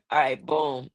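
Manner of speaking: usual speaking voice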